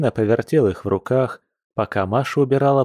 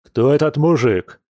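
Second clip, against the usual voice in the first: higher, restrained angry demand; mild roar; 4 semitones higher, 3 dB louder than usual. A narrator's reading. Bear jumps up to question her, mildly roaring. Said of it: "рявкнул медведь".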